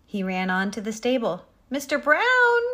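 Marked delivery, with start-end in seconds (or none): 1.7-2.6 high, loud searching call. A narrator's reading. Pig looks for the horse at the stable, calling. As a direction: holler the name across the yard. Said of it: "he called".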